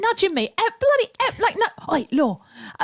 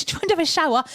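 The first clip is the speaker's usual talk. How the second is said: ordinary talk, nothing marked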